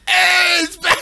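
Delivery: high, loud mock cheer; excited commenters. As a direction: shout it like fans celebrating a comeback. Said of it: "I'm sure we'll get more comments".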